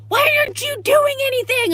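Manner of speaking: very high, loud imitated shriek; screaming. Dog owner yelling at bystander during dog fight, imitated shrill scream. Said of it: "she's screaming".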